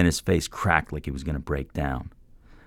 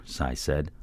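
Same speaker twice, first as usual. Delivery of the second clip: plain reading voice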